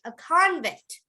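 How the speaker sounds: "convict" as CON-vict; stress on first syllable. Shown as a demonstration. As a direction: lift CON in "convict", then fall. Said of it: "Now, how would you say the verb".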